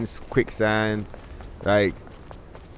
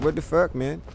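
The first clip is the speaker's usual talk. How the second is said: light, higher, slightly softer hopeless sigh of a phrase; really light tone; 5 semitones higher, 3 dB quieter than usual. Shown as a demonstration. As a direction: demo the giving-up voice, lifted and light. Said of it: "in a really light tone like that".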